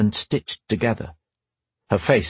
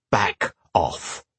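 growled; 2 semitones lower, 3 dB quieter than usual. Acted. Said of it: "he growled".

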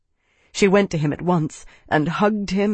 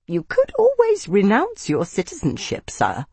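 much higher, squeaky nervous voice; squeak; 6 semitones higher than usual. A narrator's reading. Nervous advisor to his senator, squeaky and much higher. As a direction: squeak it out in fear. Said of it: "his voice came out in a squeak".

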